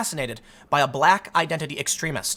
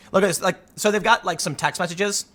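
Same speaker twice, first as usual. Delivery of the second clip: ordinary talk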